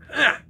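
sudden loud, high yelp of pain; real, short pained "ah"; pained cry. Real, not acted. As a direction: yelp from a sore body. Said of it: "Man, I'm sore".

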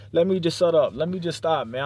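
usual speaking voice

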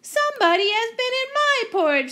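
very high, quick little-bear squeal; Baby Bear. Acted. Baby Bear finding his porridge gone, very high upset voice. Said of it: "said Baby Bear".

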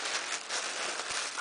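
long, breathy gasp of delight; amazed gasp. Real, not acted. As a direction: gasp softly in awe. Amazed while unboxing a gift.

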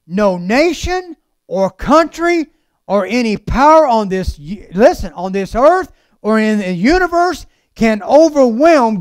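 emphatic preacher with big rising swells; preaching. Acted. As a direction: preach it in strong, spaced phrases. Preaches at full force.

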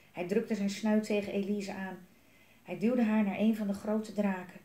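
ordinary delivery, nothing marked

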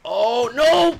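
loud, high cry of dismay. Real, not acted. Gamer dying yet again, loud dismayed cry. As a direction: shout it as you die again.

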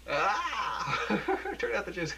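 high giggling laughter; laughing. Acted. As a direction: break up laughing. Giggling fit mid-scene.

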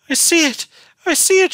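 very high, loud horrified cry; frantic high-pitched shriek. A narrator's reading. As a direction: shriek it in dawning horror. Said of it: "shrieked Beatrice".

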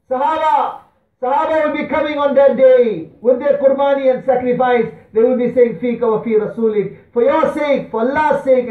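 high, loud rallying sermon cry; ringing preacher's promise, pitched high; impassioned preaching. Acted. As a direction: proclaim it with full conviction. Preaches at full force.

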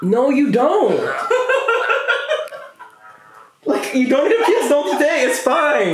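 laughing. Real, not acted. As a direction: laugh through the words. Laughing at the clerk's call.

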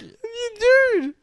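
a very high, loud cry of dude; excited shout. Real, not acted. Friend hears the huge like count, shouting. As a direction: yelp it out in disbelief.

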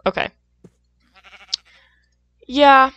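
ordinary delivery

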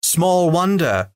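slow, high mocking echo; the elves mock the butler, echoing his words slowly. A narrator's reading. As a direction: throw his words back at him. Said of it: "said they".

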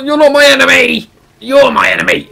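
loud, high challenge to a foe; mock battle cry. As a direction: yell it at your opponent. Shouting at game opponents.

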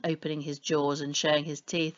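ordinary delivery, nothing marked

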